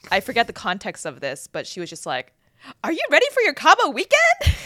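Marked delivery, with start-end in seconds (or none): 2.8-4.5 loud, high imitation of an eager friend; squealed, oblivious excitement; excited blurting. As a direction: gush it like a hyped-up friend.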